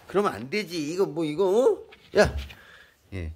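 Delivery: ordinary talk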